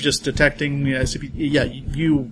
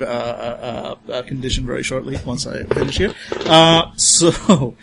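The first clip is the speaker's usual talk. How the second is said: ordinary delivery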